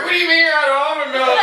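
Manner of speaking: high, loud little lap dog; tiny dog voice. Retold or imitated. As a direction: pitch way up as the squeezed lap dog. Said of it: "And the dog was like".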